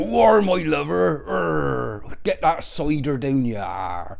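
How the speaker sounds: high, loud, slow pantomime West Country drawl; mock an overdone West Country yokel, stretching every word; hammed-up West Country accent. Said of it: "I'm gonna do a West Country accent".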